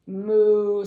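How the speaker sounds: loud mooing cow. Pretend. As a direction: belt out a big moo. Cow says moo in book.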